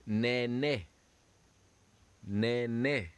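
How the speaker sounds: "Nene" said neh-neh; French word drill. Shown as a demonstration. Teacher models the French word for students. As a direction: model "Nene" clearly for the class.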